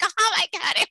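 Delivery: very high mock fangirl squeal; high-pitched acted gush; starstruck and silly. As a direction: go giddy over a celebrity, hammed-up. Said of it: "I get stupid when I meet a celebrity".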